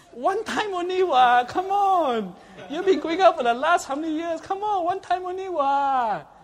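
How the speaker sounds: high, loud mocking Singlish. Mocking the excuse, much higher and louder.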